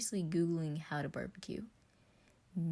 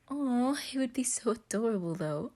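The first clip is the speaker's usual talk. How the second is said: higher, louder, gushing aww; 7 semitones higher, 5 dB louder than usual. Acted. Virgil gushing about his brother's crush. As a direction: melt over how cute it is.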